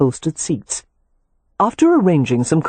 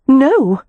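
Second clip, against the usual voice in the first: "No" said high and loud; emphatic; 10 semitones higher, 5 dB louder than usual. A narrator's reading. Sister corrects her brother, emphatic "No". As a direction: hit "No" firmly, pitched high. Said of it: "she said emphatically".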